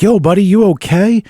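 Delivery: very high-pitched imitation of a friend playing concerned; mock concern. Friend imitating John checking on the gasping guard, mock concern. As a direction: pitch it way up, acting all innocent and helpful. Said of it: "John's acted like this to the guy".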